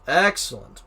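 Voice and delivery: loud gloating Excellent; mad scientist. Pretend.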